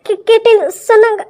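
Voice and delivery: nervous stammer. Acted. Girl stammering her excuse to the angry brother.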